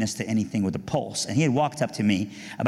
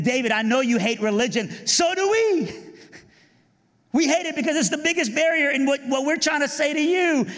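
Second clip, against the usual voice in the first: much higher, earnest youth-group voice; eager teen voice. Retold or imitated. Youth group pleading with David at his house, eager and high. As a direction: play the keen church kid pleading.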